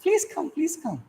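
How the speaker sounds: high, sugary please come; forced cheerful welcome. Retold or imitated. Host welcomes an unwelcome visitor with put-on delight. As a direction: sound delighted while secretly fuming. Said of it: "Inside I am angry, but outside".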